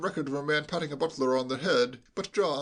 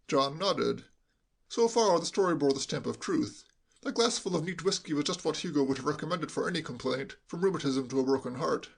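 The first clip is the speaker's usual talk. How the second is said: plain reading voice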